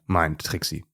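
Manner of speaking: ordinary delivery, nothing marked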